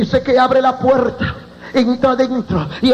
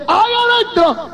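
high-pitched doctor's outcry; startled cry; 10 semitones higher than usual. Retold or imitated. Doctor finds someone alive among the dead, startled cry. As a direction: call out in alarm on a high pitch.

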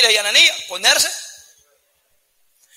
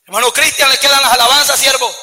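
calling out; 4 semitones higher, 7 dB louder than usual. Acted. Pastor assigns duties loudly.

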